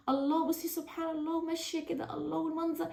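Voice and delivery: a hint of breathless wonder; soft awe. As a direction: marvel at the fish softly. Admiring the fish's colours.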